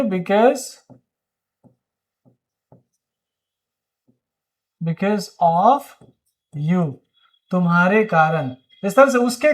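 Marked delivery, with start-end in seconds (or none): 0.0-6.9 because of you with long pauses between the words. Shown as a demonstration. Teacher drilling the phrase word by word for students. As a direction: hold a pause before each word. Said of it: "क्या बोलेंगे?".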